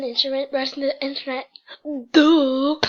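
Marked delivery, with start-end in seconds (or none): 2.1-2.8 shouted sarcastic duh; mock-obvious yell; loud duh. Real, not acted. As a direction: blurt out duh like it's obvious.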